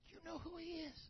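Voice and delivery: soft whispered aside; whispering to neighbour. Retold or imitated. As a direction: lean in and whisper the question.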